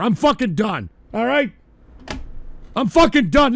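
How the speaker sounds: usual speaking voice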